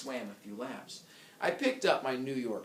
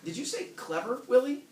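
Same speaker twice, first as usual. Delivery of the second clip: higher and louder wife voice; a pitched-up wife calling her husband; wife on the phone; 11 semitones higher, 6 dB louder than usual. Acted. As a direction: call him sharply, rising on Willie. Rereading Helen's line, more forceful.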